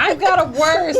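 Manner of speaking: laughing. Real, not acted. Shared laughter over worst.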